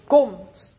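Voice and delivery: a sudden loud far-reaching call. Acted. Preacher calling sinners with a loud call. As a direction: call out the invitation from the pulpit.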